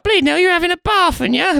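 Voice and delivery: high, loud Essex nan; nan's Essex accent. Retold or imitated. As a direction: squawk it like a London-Essex granny. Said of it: "unironically says stuff like".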